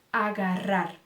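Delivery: agarrar with a rolled rr, low; trilled rr. Shown as a demonstration. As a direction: roll the rr in agarrar. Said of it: "Então, carro". (agarrar com rr vibrante)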